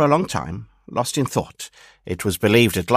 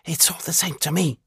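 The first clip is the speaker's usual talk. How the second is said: high, soft little flea voice; 7 semitones higher, 5 dB quieter than usual. A narrator's reading. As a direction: pipe it up small and quiet like a flea. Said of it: "said the flea".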